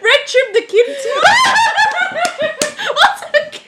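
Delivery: high squealing laughter through the words; can barely get it out for laughing; real helpless giggles; laughing fit. Real, not acted. Giggling fit over red tube.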